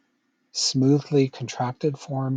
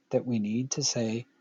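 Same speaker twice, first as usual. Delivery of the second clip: normal talking voice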